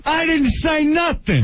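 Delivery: high, drawn-out protest; protesting innocence. Real, not acted. Radio host denying the caller's accusation, protesting innocence.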